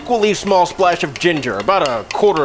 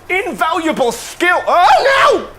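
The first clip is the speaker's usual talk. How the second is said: surprised shout; 12 semitones higher, 4 dB louder than usual. Real, not acted. Spill mishap, laughing shout.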